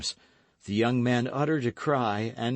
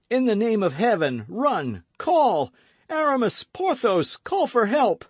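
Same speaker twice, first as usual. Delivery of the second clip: frantic shouting; 11 semitones higher, 6 dB louder than usual. Acted. D'Artagnan calling friends to help.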